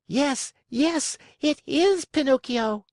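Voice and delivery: high shrill-pitched puppet scream. A narrator's reading. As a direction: cry it out with joy. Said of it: "screamed Pulcinella".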